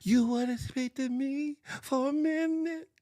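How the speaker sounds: high, trembling imitation of the pastor. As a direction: push the voice way up, trembling. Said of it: "His trembling voice went ten octaves high".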